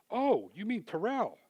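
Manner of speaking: high, slow feigned innocence; hammed-up surprise; mock surprise. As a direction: play dumb, drawn out.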